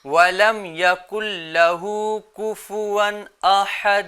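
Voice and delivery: slow, measured tajweed recitation; Quran recitation. Shown as a demonstration. Teacher models the verse for children, slow recitation. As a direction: recite slowly with drawn-out vowels.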